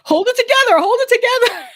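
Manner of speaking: high falsetto urging, hold it together; imitating husband, very high and pleading; husband's urging. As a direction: squeak out a mock husband's plea to stay composed. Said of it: "my husband was like".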